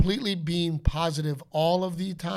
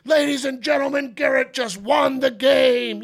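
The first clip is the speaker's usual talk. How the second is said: stadium announcer; 8 semitones higher, 7 dB louder than usual. Acted. Dad announcing his son's game-winning play, announcer shout. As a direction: boom it out over the ballpark.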